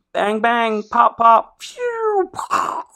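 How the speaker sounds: bang bang pop pop, tossed off like a kid playing war; mock gunfire noises. Podcaster mimics battle gunfire, playful sound effects. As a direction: sound out the guns and cannons.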